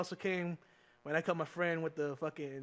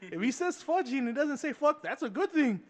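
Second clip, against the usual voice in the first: mock heated defence, pitched up; acted fierce mom; 6 semitones higher, 4 dB louder than usual. Mom defending her son to teachers, heated. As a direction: argue your son's case with a raised voice.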